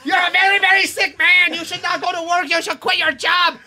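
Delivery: high-pitched panicked doctor yelling; doctor shouting that he's very sick; alarmed doctor. Retold or imitated. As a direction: shout it like a frantic doctor delivering dire news. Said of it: "he goes".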